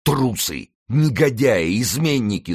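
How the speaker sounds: high-pitched cries of outrage; angry outcry. A narrator's reading. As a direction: fling each insult at deserting troops. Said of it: "слышался голос Винтера". (гневные выкрики)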